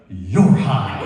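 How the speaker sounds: low, loud cop voice; former cop's verdict. Retold or imitated. Ex-cop to his own reflection, deep authoritative accusation. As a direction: boom it out deep, like an officer. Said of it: "As a former cop, I go".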